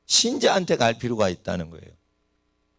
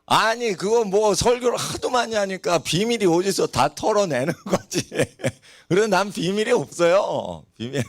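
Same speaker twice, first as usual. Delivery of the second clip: higher pitch with real chuckles breaking in; amused, laughing; 5 semitones higher than usual. Real, not acted. Preacher admits sermons spill all his secrets, laughing. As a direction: confess it through a laugh.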